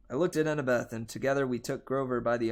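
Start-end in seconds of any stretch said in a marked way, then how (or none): none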